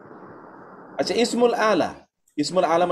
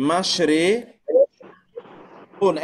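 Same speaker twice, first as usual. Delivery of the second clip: slow demo; much slower than usual. Shown as a demonstration. Teacher drawing out a word.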